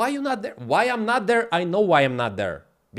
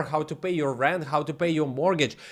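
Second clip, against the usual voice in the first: ordinary talk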